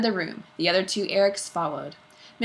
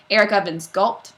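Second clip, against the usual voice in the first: ordinary delivery, nothing marked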